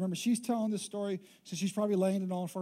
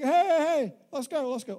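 higher and louder hey, eager hurry; hammed-up husband urging her to open up; eager mock call; 4 semitones higher, 4 dB louder than usual. Husband knocking at 3 AM.

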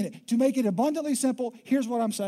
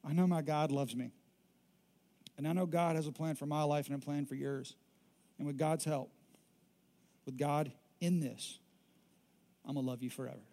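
soft, tender promise, trailing quieter; tender sincere vow; 6 semitones lower, 7 dB quieter than usual. Shown as a demonstration. Pastor voicing a spouse's vow, softly and sincerely. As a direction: say it gently, like a real vow to a spouse. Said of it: "what you can say".

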